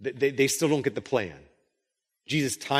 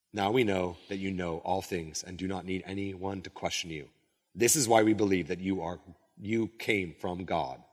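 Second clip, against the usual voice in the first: low, hushed Bible reading; measured reading from scripture; 7 semitones lower, 5 dB quieter than usual. Acted. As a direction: read the passage solemnly, softly and low. Reads scripture aloud.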